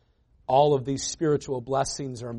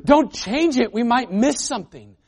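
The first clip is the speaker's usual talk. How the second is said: higher, louder urgent plea; anxious grandmother; 8 semitones higher, 7 dB louder than usual. Retold or imitated. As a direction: grab the remote back in alarm. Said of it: "she'd go".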